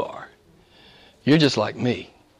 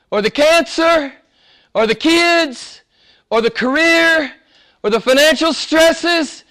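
much higher, louder, slow proclamation; preaching at full force; 14 semitones higher, 4 dB louder, slower than usual. Acted. Preacher charges the church to obey in everything, full force. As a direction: thunder it from the pulpit.

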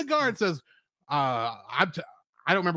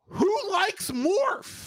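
incredulous; 10 semitones higher, much slower than usual. Retold or imitated. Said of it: "And I'm like".

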